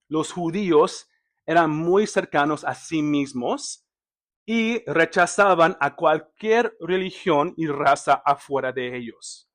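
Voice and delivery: ordinary delivery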